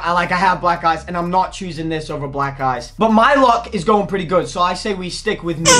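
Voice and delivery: ordinary delivery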